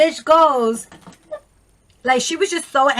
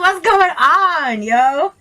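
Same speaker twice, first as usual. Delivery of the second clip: higher, louder, stretched-out 'what's going on'; retold bafflement; 6 semitones higher, 4 dB louder, much slower than usual. Friend recalling her reaction to a bad shave, baffled exclamation. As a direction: exclaim it slowly, drawing the words out.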